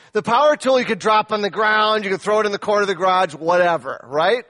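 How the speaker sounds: loud, high yell at the men. Real, not acted. Pastor yelling at the men about power tools. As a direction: bellow it out to grab the room. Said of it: "Guys, I yelled at you a second ago".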